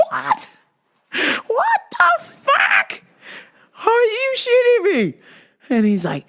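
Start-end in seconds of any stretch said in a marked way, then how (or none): 0.0-5.1 high, slow, shouted disbelief; stunned shout. Retold or imitated. Podcaster reacting to the rapper wanting to come on, shouting in shock. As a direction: cry it out like you can't believe it. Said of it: "And I went".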